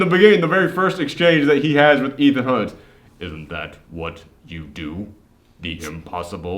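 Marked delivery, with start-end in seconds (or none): 3.2-6.6 deep, low, soft mock-American growl; low muttered fake American accent. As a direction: impersonate Cavill straining at an American accent. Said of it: "He can't do American accents".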